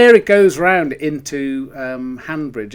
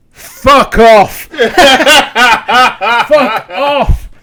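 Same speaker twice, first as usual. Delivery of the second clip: high, loud laughing protest; laughing disbelief; 7 semitones higher, 8 dB louder, much slower than usual. Retold or imitated. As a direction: imitate the runner laughing it off. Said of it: "And he went".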